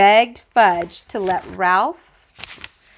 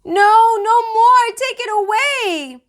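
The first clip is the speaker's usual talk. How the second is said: put-on high, loud frightened child; terrified scream; 5 dB louder, slower than usual. A narrator's reading. Sam terrified of the jack-in-the-box, high and loud. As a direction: scream it away in panic. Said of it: "Sam started to scream".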